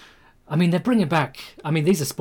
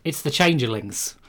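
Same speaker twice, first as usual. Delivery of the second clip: ordinary talk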